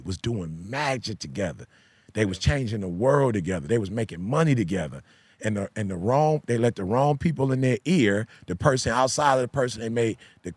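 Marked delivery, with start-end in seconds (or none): 0.0-5.0 low, measured delivery; slow it down, voice dropped low; said slowly. Real, not acted. Telling of couples who made magic together, said slowly. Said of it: "I'm gonna say it slowly".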